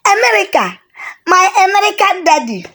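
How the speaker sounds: put-on breathless, high child's squeal of delight; excited exclaim. A narrator's reading. Girl greeting her American daddy, high and thrilled. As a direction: gasp it out, thrilled. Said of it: "Ogechi exclaimed".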